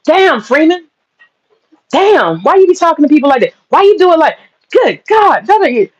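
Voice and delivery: high, whiny imitation of her campaign workers; mock complaining staff.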